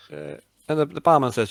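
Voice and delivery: ordinary delivery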